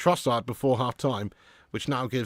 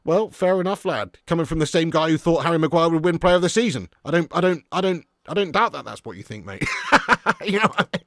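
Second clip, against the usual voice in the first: normal talking voice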